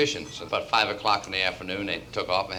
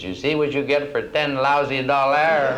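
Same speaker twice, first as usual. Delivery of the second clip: put-on loud, slow, higher French-accented jeer; scornful French accent; 5 semitones higher, 6 dB louder than usual. Parisian girl points at his wife, scornful French accent.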